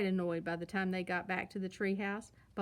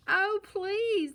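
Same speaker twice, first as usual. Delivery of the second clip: much higher, louder begging shout of cubs; shouted plea; 10 semitones higher, 8 dB louder than usual. A narrator's reading. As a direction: yell the plea like kids at a tantrum. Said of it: "they shouted".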